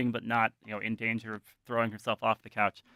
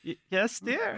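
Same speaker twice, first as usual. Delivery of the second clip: high, sweet-wife voice; Doreen answering; 11 semitones higher than usual. Acted. Game master as Doreen answering the call, high-pitched. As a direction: answer a call in a much higher put-on voice.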